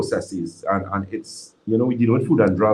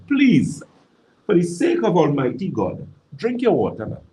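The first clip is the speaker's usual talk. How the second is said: emphatic plea; 5 semitones higher than usual. Real, not acted. Doctor begging anyone listening to drink water, emphatic plea. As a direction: implore them, pitched up, with pauses between phrases.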